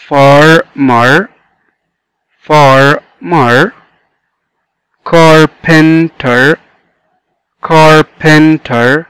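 "farmer" in two beats, "carpenter" in three; syllable by syllable. Shown as a demonstration. Teacher repeating for the class, "farmer" and "carpenter" broken up. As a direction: slowly separate each syllable of "farmer" and "carpenter". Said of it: "فمرة تانية".

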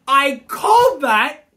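ordinary talk

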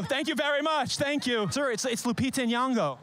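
ordinary talk, nothing marked

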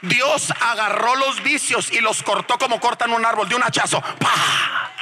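high, shouted revival preaching; preaching at full force. Acted. Preacher exhorting the church to praise, full-force shouting. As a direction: fire up the crowd with blessings.

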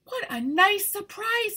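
high bright greeting voice; sunflower voice. Acted. Sunny greets her visiting flower friends, high voiced. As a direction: greet old friends with delight. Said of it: "bending low to greet her friends".

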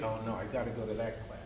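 quiet groaning reluctance, quickly; mock-dread of a class; dreading student. Teacher imitating a student who hates the class. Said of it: "You either love it or hate it".

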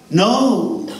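ordinary delivery

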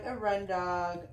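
ordinary delivery

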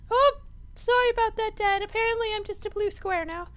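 very high squeaky falsetto; little son voice. Pretend. Son apologizing to dad, squeaky child voice. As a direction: apologize in a tiny kid voice.

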